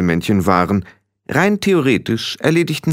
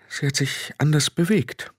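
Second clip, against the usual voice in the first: higher, faltering woman's voice; rattled Frau Grad; 8 semitones higher, 3 dB quieter than usual. Acted. Frau Grad tells Rob how Tiffany changed, unsettled. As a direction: recount it uneasily, with halting pauses.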